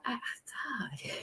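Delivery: ordinary delivery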